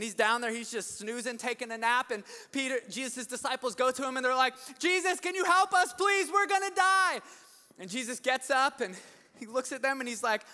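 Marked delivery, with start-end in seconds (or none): 4.8-7.2 high, loud panicked plea; mock panic. Frightened disciples waking Jesus in the storm, mock panic. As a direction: cry for help in hammed-up terror. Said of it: "they're all freaking out".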